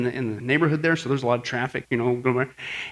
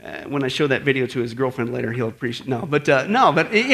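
usual speaking voice

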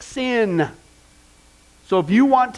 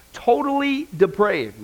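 slow emphasis; 3 semitones higher, slower than usual. Shown as a demonstration. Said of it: "your two blanks there".

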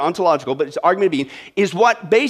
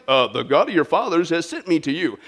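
lower, softer, hesitant Moses pleading; mock timid Moses; 5 semitones lower, 3 dB quieter than usual. Moses rehearsing his words to God, unsure and subdued. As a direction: play a timid messenger mumbling his line. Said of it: "I say to them".

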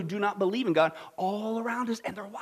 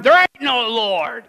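slow, louder angry retort; shout back; 7 dB louder, much slower than usual. Retold or imitated. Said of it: "He would shout back".

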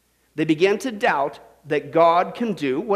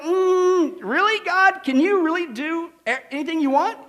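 higher, skeptical mock questioning; a lightly voiced skeptical Moses; doubtful Moses; 6 semitones higher than usual. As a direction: poke at God like a doubting Moses. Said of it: "Moses had a time where he's like".

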